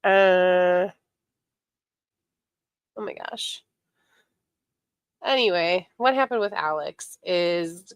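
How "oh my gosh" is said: whispering. Real, not acted. Losing her train of thought.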